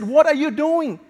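a retold doctor's upset outburst. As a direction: snap at him in alarm. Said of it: "the doctor got so upset".

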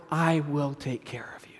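breathy hushed promise, no voice at all; whisper. Retold or imitated. Joseph whispers his promise to his frightened brothers. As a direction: whisper it like a secret vow of protection.

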